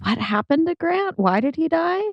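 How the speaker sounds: normal talking voice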